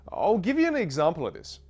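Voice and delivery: ordinary talk, nothing marked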